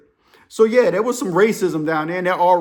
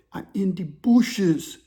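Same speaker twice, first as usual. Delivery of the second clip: put-on slow, drawn-out African accent; African-accented words, carefully spaced; imitated African caller; much slower than usual. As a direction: play the hiding African caller slowly. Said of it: "The African dude's whispering".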